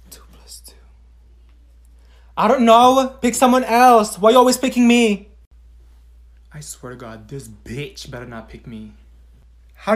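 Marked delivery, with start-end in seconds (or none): 0.1-0.7 breathy classroom whisper. Acted. Student prompts answer secretly. 6.5-8.9 quiet, lower grumble to herself; muttering under breath. Acted. Student dreading being called on.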